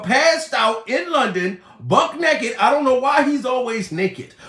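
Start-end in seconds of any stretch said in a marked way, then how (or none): none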